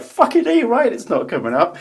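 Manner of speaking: very high, loud retold outburst; exasperated retort. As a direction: fire it back at a yell, hammed-up. Retells his own outburst back.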